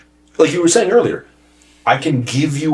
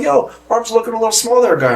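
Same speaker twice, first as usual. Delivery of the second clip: higher, brash ribbing voice; mock teasing coworker. Coworker teasing Nate about small arms, higher brash voice. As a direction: rib him like a gym bro.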